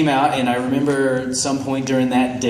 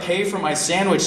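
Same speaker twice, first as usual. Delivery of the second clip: normal talking voice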